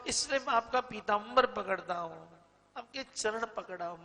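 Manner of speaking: lightly acted distressed appeal to his Lord; tearful pleading. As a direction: plead with rising pitch, no real sobbing. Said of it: "रोने लगे जोर जोर से".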